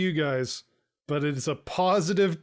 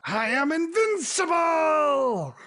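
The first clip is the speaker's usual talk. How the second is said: high, slow, gleeful shout; triumphant shout; 12 semitones higher, 4 dB louder, slower than usual. Acted. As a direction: crow it like a movie villain. Player shouts triumphant character line.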